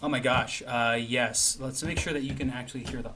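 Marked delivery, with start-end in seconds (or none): none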